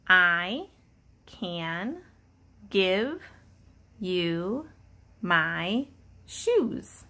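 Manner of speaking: each word spaced out slowly, pausing between; word-by-word reading. Shown as a demonstration. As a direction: read it one word at a time for a child. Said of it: "Let's read it together".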